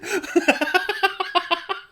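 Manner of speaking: squealing laugh; high giggle. Real, not acted. Laughing mid quote.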